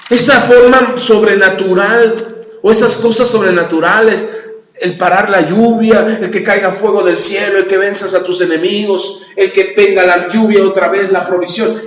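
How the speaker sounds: loud, ringing pulpit voice; loud preaching. Acted. Preacher proclaiming miracles to the congregation, loud. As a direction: proclaim it to the whole church.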